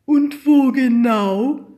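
high, loud and slow question; ask, truly confused; puzzled kid voice. A narrator's reading. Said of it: "fragte Pekka".

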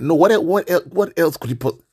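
exasperated outburst. Real, not acted. Ranting to listeners about a friend's pointless question, exasperated. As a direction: burst out, stumbling over the words in disbelief.

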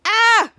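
high loud "ah" of discovery; excited shout. Retold or imitated. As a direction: yelp it out in triumph. Schwoz finds something.

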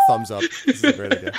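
very high laughing goblin squeak; squeaky sidekick. Acted. Gleek giving the thumbs up, squeaky and giddy.

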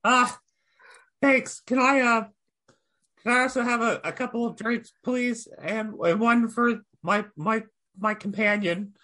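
high, hesitant goblin voice; high goblin voice addressing the barmaid; timid goblin. Acted. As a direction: creep up and ask nervously, pitched high. Goblin orders drinks.

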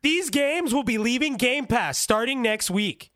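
mock the breezy influencer voice, stretched and lifted; TikToker delivery. Podcaster mocking TikTokers to friends, slow sing-song influencer read. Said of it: "that's literally how they all sound".

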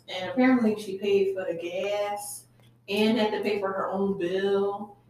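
ordinary talk, nothing marked

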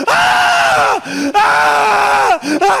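loud, high drawn-out screams; imitated screaming. Imitating the friend screaming in his living room, loud high screams. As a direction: scream over and over, full voice. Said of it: "stop screaming, for fuck's sake".

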